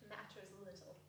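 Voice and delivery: put-on hushed, hurt young woman; soft. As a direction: let the words barely leave her. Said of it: "she said softly".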